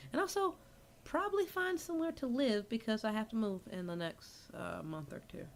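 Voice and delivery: soft, low-volume murmur; very quiet. Real, not acted. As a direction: talk softly as if far from the mic.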